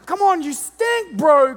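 put-on high, loud exasperated shouting at boys; mock yelling. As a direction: holler at the boys to quit messing. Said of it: "you gotta be like".

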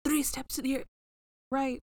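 beam directions into their heads, playfully; mental commands. Pretend. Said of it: "mentally hollering directions".

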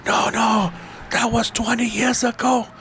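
high, slow mocking retort; imitating the new owner. Owner brushes off their old claim, high slow mock voice. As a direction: lift the voice high and drag it out dismissively. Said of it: "owner retorting, quoted".

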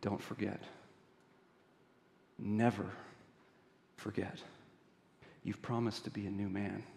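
very soft and low, long pauses between phrases; hushed, solemn reminder, leaving space after each phrase; quiet charge. Acted. Bishop to Jean after police leave, quiet and solemn. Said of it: "says to him quietly".

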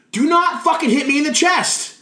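high, loud exasperated shout; fed up and shouting at a partner who keeps hitting you; retold angry yell. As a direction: lose patience and yell it at him. Fed-up retort during promo.

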